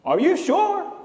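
high, loud mock-doubtful question; doubting professor. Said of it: "and he said".

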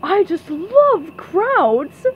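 high, loud, very drawn-out gushing; princess voice. A narrator's reading. Princess telling SpongeBob why she stopped, slow gushing. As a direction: stretch it out adoringly. Princess gushes about crowds.

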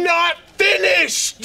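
furious shouting boss; angry shout. Real, not acted. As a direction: yell him down. Boss yelling over employee.

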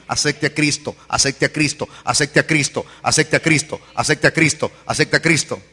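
low, soft, insistent loop; repeated refrain. Retold or imitated. Preacher replaying what the damned will remember, low repeating refrain. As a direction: repeat it evenly like a remembered echo. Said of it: "todo lo que aquí se le repetía".

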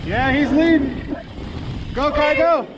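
calling from far away. Real, not acted. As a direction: call out to the running dog. Calling dog running far ahead.

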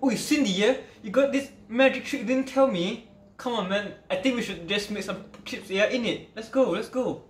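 light mock-British accent, high and soft; put-on UK accent. Redoing the magic reaction to Cindy in a hint of UK accent. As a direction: have a go at a playful UK accent.

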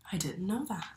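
soft whispered aside; awed whisper. Acted. Little book creature whispers to the reader, hushed and awed. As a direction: breathe it like a secret discovery.

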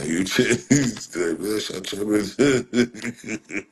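breaking into laughter mid retort; cracking up while telling chat off; laughing. Real, not acted. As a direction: laugh through the words, drawn out.

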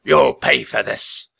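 slow, loud, raspy threat; raspy king's angry vow; shrieked. A narrator's reading. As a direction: drag out the threat furiously. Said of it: "the Raven King shrieked at Rock".

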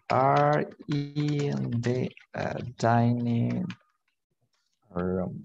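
very slow, vowels drawn out; drawn-out teacher dictation. Shown as a demonstration. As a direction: stretch the words as if writing them. Teacher dictates sentence while writing.

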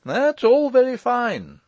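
high, loud, drawn-out retort; arguing lawyer. Acted. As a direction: counter him slowly, pitched right up. Lawyer disputing uncle's claim.

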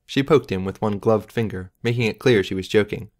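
ordinary delivery

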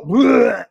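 loud fake retch of disgust; bleh, like throwing up at the thought; mock gagging noise at the guest; mock vomiting. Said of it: "throwing up".

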